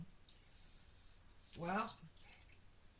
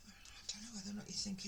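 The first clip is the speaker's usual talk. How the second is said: fast hushed aside; quick whisper; 10 dB quieter, much faster than usual. Real, not acted. Reader confiding to viewers, fast whisper. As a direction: rush it out under your breath. Reader drops voice mid reading.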